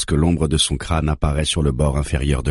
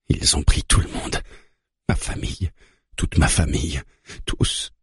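voice breaking. A narrator's reading. Mara recalls losing her family, voice mildly breaking. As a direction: let the voice crack on her family, understated. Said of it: "Sa voix se brise".

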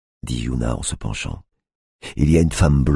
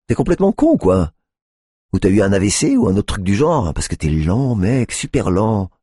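sneering; 7 semitones higher, 4 dB louder than usual. A narrator's reading. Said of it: "dit-elle en ricanant".

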